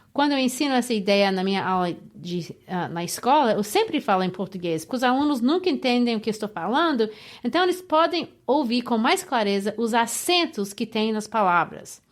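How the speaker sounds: Portuguese spoken to show its beat; Portuguese with a clear syllable beat. Shown as a demonstration. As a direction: let the rhythm of Portuguese come through. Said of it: "let me illustrate by speaking in Portuguese".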